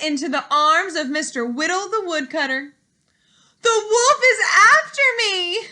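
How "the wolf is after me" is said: high, loud frightened cry; a little girl calling for help; cried out. Acted.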